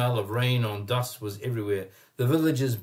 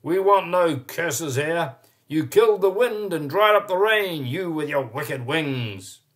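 angry shout; 5 semitones higher, 6 dB louder than usual. A narrator's reading. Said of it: "he shouted".

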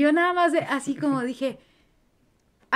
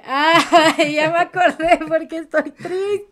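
louder, higher, laughing through the words; laughing mock realization; 7 semitones higher, 12 dB louder, slower than usual. Telling the host how small things set off tears, laughing. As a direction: act out a silly sudden realization. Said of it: "es como de".

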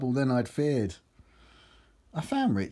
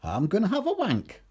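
higher, lively quoted dialogue; comic character voice; 10 semitones higher than usual. Acted. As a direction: act it out as excited banter. Acted dialogue in comic poem.

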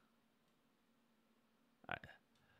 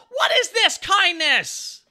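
higher, slow, stretched-out amazement; amazed disbelief; 7 semitones higher, 4 dB louder, much slower than usual. Real, not acted. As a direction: drag the words out in delighted shock. Reacting to a gifted sub.

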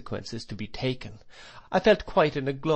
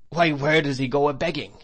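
higher, louder incredulous question; crying out; 5 semitones higher, 5 dB louder than usual. A narrator's reading. Headmaster demanding proof from the boy, crying out. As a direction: throw the question out in raised voice. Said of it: "cried Mister Creakle".

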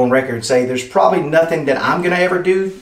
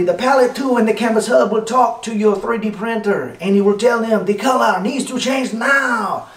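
high, overdone French accent ending in a mock shout; 6 semitones higher than usual. Explaining how the devices talk to the printer, mock French accent. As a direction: keep the silly French voice going and call the last line out. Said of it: "I'm not gonna keep doing that voice".

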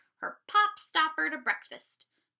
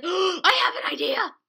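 sudden loud high cry; excited discovery; 8 semitones higher, 12 dB louder than usual. Acted. Isabel finds her plan, loud excited cry. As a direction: burst out with the idea.

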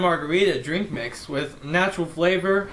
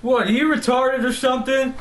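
incredulous; 7 semitones higher, 3 dB louder than usual. Acted. Insults the dead guy.

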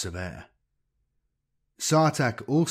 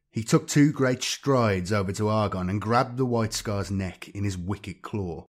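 usual speaking voice

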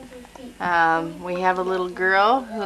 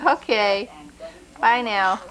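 ordinary delivery

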